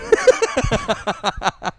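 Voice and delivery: high, loud giggle fit; loud laugh. Real, not acted. Laughing at her own joke, high giggles. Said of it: "never heard her laugh this loud".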